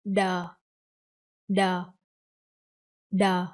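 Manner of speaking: THE said as "duh", gently falling; crossed-D sound. Shown as a demonstration. Teacher shows the crossed letter, THE as a hard "duh". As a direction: model a hard D syllable for THE. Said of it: "you will pronounce this one".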